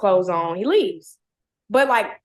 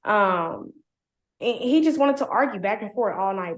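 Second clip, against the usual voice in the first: ordinary delivery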